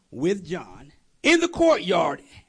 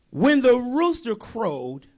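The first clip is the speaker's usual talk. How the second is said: ordinary delivery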